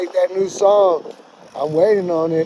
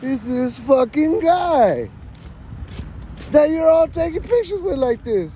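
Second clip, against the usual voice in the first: high jealous outrage; 9 semitones higher than usual. Retold or imitated. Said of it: "I was like".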